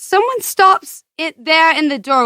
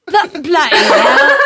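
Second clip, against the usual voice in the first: failed British attempt; 3 dB louder than usual. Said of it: "I can't do it".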